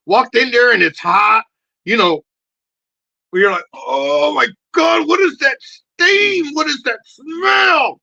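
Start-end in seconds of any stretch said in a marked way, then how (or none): 3.7-8.0 much higher, louder, slow crying shout; disgusted outcry. Retold or imitated. Walking into the hot restroom, shouting in disgust. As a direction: wail at the stench.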